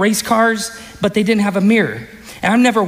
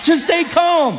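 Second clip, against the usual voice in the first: shouted calm; 8 semitones higher, slower than usual. Acted. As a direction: fire it back at the crowd. Preacher repeating verse as shout.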